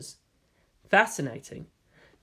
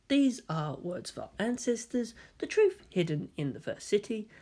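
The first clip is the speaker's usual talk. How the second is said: hushed, much softer scripture reading; reverent recital; 4 semitones higher, 7 dB quieter than usual. Acted. Makoto drafting the scripture aloud for the staff, hushed and reverent. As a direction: intone it softly like sacred words.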